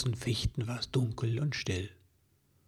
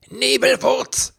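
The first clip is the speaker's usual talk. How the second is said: long, drawn-out furious yell, much higher and louder; an angry elf yelling for his friend; shout; 13 semitones higher, 7 dB louder, slower than usual. A narrator's reading. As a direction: bellow the name across the clearing. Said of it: "schrie er".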